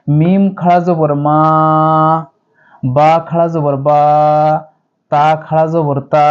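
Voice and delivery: slow, vowels stretched one alif long; drawn out one alif. Shown as a demonstration. Teacher showing students the long vowel, slowly drawn out. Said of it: "এভাবে এক আলিফ টেনে পড়বো".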